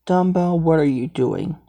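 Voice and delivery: usual speaking voice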